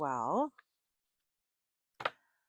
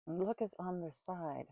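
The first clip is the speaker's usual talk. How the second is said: softer, slow, thinking it over; pondering the tarot card, half to herself; quiet musing; 5 dB quieter, slower than usual. Real, not acted. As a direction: repeat it to yourself as it sinks in.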